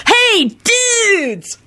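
very high, elated yell; ecstatic scream. A narrator's reading. Kenny bursting into the room, ecstatic screaming. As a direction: scream it out in sheer joy. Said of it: "Kenny screamed ecstatically".

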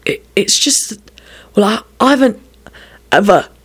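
halting, broken-off confession with gaps; faltering. A narrator's reading. Young man unable to finish his confession, haltingly. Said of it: "He found he couldn't finish the sentence".

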